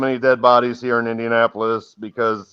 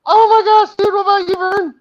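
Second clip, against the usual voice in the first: very high fangirl squeal; gushing teenage girl; 4 dB louder than usual. Pretend. Mod gushing to co-host over view numbers, teenage-girl squeal. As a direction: squeal like a starstruck teen. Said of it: "you sound like a teenage girl".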